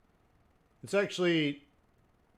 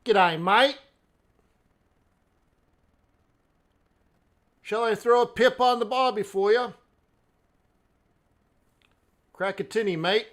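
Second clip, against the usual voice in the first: loud, high hammed-up Aussie accent; over-the-top Australian accent; 9 semitones higher, 10 dB louder than usual. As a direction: play a broad Aussie bloke, much higher and louder. Aussie slang greeting, put-on voice.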